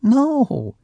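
lying no. A narrator's reading. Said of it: "she lied".

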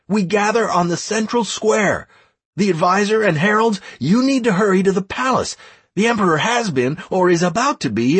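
high, urgent run of commands; shouting orders. A narrator's reading. Leader dispatching clans and advisors, shouting orders. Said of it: "I shouted to the leader of some other clan".

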